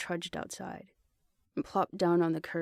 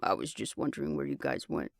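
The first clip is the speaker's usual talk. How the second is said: much lower, simple male voice; man voice; 8 semitones lower than usual. A narrator's reading. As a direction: voice Blake low and matter-of-fact. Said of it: "he answered simply".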